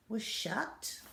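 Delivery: plain reading voice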